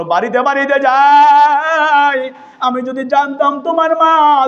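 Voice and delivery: high chanted plea in a sermon; melodic preaching. Retold or imitated. Preacher voicing Omar to the mother, melodic preaching. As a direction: chant Omar's words high and sing-song.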